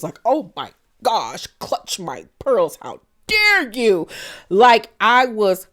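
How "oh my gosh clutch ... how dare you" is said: slow, mock-offended pearl-clutcher. Mocking Beverly Hills girls in a prissy offended voice. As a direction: gasp and clutch your pearls, slowly.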